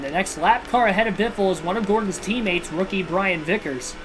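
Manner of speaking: usual speaking voice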